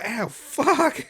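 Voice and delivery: sudden high-pitched curse; real pain yelp. Real, not acted. Host just hit his own head, real pained curse. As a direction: yelp it as a bump lands. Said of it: "hit my head".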